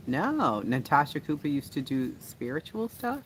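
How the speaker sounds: normal talking voice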